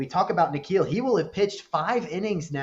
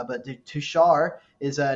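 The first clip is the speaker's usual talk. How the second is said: usual speaking voice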